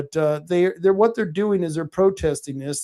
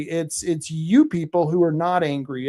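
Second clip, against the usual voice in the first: ordinary delivery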